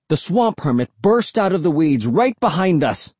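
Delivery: usual speaking voice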